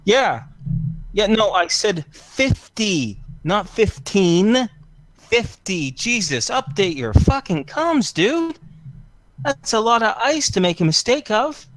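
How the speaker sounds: high, exasperated snapping into a comms bud; annoyed broker barking down the line; irritated phone rant. Acted. Angry call on his earpiece.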